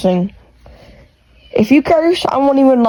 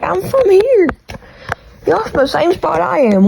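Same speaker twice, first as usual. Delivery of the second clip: higher-pitched, protesting comeback; mock-indignant reply, pitched up; indignant retort; 8 semitones higher than usual. Answering commenters who question the accent, mock-indignant.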